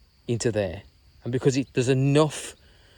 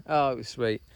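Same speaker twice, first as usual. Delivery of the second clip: ordinary talk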